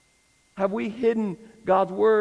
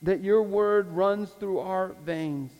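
public prayer. Real, not acted. Pastor asks God to grow the congregation, earnest closing prayer. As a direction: pray it out phrase by phrase, with long pauses.

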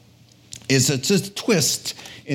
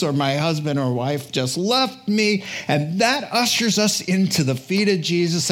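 ordinary talk

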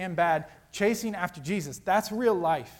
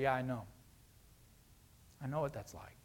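gentle reassurance; 5 semitones lower, 11 dB quieter than usual. Acted. Jesus consoling, soft reply.

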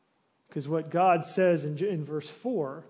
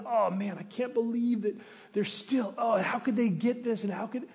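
higher, exasperated mock grumbling; mock exasperated grumble; 6 semitones higher than usual. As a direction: play the bitter person who can't believe it. Imitating a resentful, consumed listener.

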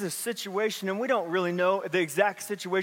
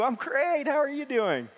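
much higher, sing-song put-on politeness; fake cheerful reply; 7 semitones higher than usual. Churchgoer answering greeting with exaggerated fake cheer. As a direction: sound perfectly fine when you aren't.

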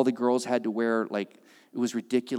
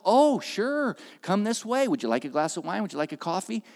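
bright fawning host voice, much higher; mock eager welcome; 6 semitones higher than usual. Hotel manager welcoming a big tour group, mock eager.

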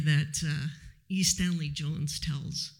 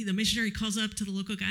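normal talking voice

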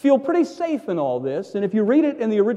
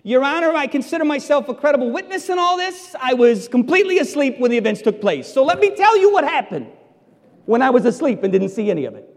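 higher, louder voice of a foolish witness; mock witness in court; 7 semitones higher, 3 dB louder, faster than usual. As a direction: play a guard testifying absurdly to a judge. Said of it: "What court would accept this testimony?".

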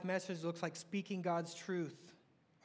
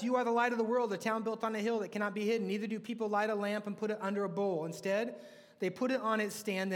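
louder, ringing sermon delivery; preacher declaring scripture to the church, louder; preaching scripture; 2 semitones higher, 5 dB louder than usual. Acted. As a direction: proclaim it to the congregation. Reads scripture with force.

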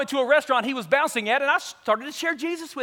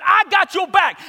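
high, loud pledge to a buddy; rowdy, emphatic backing; hyped assurance; 7 semitones higher, 6 dB louder, slower than usual. Retold or imitated. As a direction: shout it to fire him up. Friend's rousing assurance, louder.

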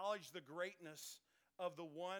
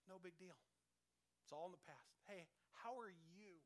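calm and easygoing; 9 dB quieter than usual. Retold or imitated. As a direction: gently brush it off, no grudge at all. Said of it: "And Jesus is like".

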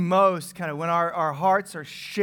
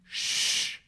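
mock shush; 3 dB quieter than usual. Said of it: "first thing God tells the prophet".

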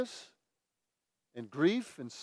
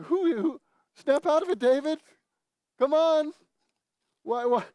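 high, loud, baffled scolding; mock-incredulous, pitched way up; acted exasperation; 11 semitones higher, 4 dB louder than usual. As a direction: play an impatient reader urging someone to buck up. Voicing baffled readers scolding David.

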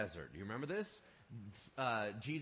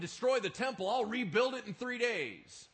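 loud and high, a defiant claim; bold proclamation; 9 semitones higher, 8 dB louder than usual. Retold or imitated. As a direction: throw it out boldly to the crowd. Bold claim the crowd mocks.

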